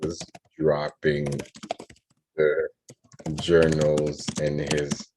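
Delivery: word-by-word story composing, long pauses. Real, not acted. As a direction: dictate it haltingly, as if writing along.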